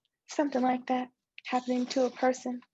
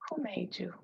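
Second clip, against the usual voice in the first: soft, hushed question; whisper; 8 dB quieter than usual. Acted. Friend asking who made her stop school, whispered. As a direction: ask it gently under your breath.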